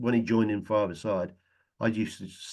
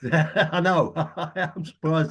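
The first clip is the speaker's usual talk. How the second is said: laughing through the words; 6 semitones higher, 3 dB louder, much slower than usual. Real, not acted. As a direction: chuckle while you answer. Laughing at the Burnley jibe.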